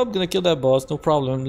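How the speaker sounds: ordinary talk, nothing marked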